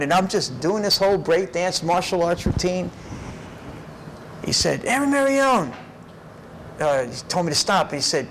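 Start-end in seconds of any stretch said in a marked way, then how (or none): 4.8-5.7 high, sharp call of the name; sergeant calling out. Retold or imitated. Sergeant stopping the dancing recruit, a high sharp call. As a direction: snap the name out higher. Sergeant calls out to stop him.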